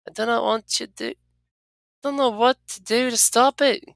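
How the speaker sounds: fear for him and not know what to do; worried companion voice. Acted.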